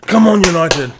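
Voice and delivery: loud, high "come on, United"; fan's shout. Real, not acted. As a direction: roar your team on. Cheering team at kickoff.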